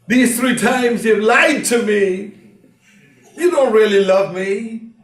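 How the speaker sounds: hurt lover accusing through tears; tearful accusation. Acted. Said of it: "Now she begins to cry".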